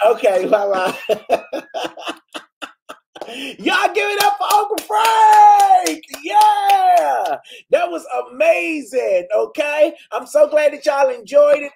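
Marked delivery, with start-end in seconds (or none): 3.6-11.7 hype shout. Real, not acted. Hyping up the live audience.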